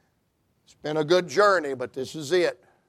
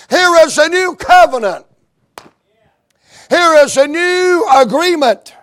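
higher, louder, thrilled announcing; excited child; 8 semitones higher, 6 dB louder than usual. Acted. Young'un showing Daddy the king's message, excited and loud. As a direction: call Daddy over with rising excitement.

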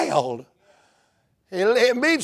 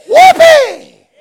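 very high, very loud shout of joy; joyful whoop; 13 dB louder than usual. Real, not acted. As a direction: let out a whoop of pure joy. Preacher's joyful outburst.

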